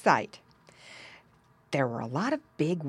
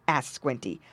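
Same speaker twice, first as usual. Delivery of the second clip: ordinary delivery, nothing marked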